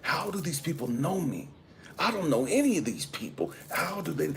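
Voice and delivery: high-pitched, baffled questioning; tripping, bewildered. Retold or imitated. Traveler wondering how the cheering tribe knows him, bewildered. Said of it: "And I'm tripping".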